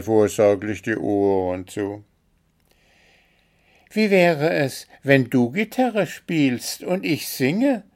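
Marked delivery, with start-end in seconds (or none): 3.9-7.8 high-pitched character making a suggestion; eager would-be musician. A narrator's reading. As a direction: propose it brightly, pitched high. Story figure, Gruseli suggests. (hohe Figurenstimme, vorschlagend)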